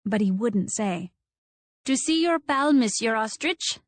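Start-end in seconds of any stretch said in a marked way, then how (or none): 1.9-3.8 put-on teasing in a light French accent. A narrator's reading. Teasing Robbie about his pal in French accent. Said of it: "I asked in a French accent".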